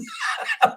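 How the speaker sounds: high burst of real laughter; helpless giggle at the punchline; cracking up at a fan's joke. Real, not acted.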